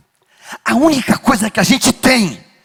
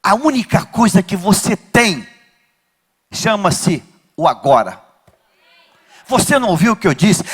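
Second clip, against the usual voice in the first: usual speaking voice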